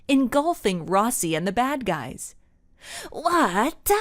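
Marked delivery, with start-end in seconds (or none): 3.1-3.9 high, startled cry; jolted with shock; screamed. A narrator's reading. Rossi caught in smoke, a high startled cry. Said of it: "he screamed".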